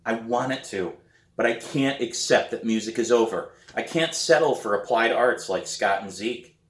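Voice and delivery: usual speaking voice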